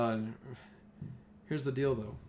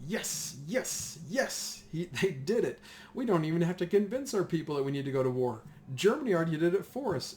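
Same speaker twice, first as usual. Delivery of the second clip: rising, louder yes yes yes, then glee; gleeful cheering; 5 semitones higher, 5 dB louder than usual. Retold or imitated. French leaders cheering that Germany declared war first, excited. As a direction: cheer like you just got what you wanted.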